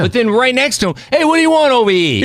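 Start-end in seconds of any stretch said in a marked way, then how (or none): 1.1-2.2 put-on brash New York accent, higher and louder, a bit fast; imitated tough New York accent, brash and loud. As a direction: call out like a pushy Manhattan street guy in a thick New York accent. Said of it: "This is why you're good at your voiceover stuff".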